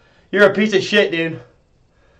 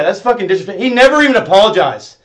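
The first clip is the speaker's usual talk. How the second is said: usual speaking voice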